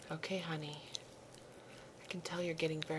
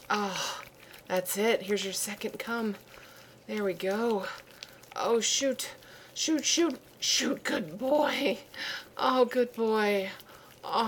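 much louder and higher, breathless exclamations; ecstatic cries; 4 semitones higher, 7 dB louder, slower than usual. Acted. Partner exulting at the second climax, in loud high ecstatic cries. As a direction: cry out in triumph.

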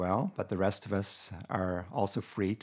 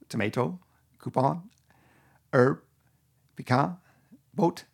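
put-on amateur Francophone Canadian accent, higher and louder; a hammed-up Québécois accent, higher and louder; 5 semitones higher, 5 dB louder than usual. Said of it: "my attempt to do, uh, Francophone Canadian accent".

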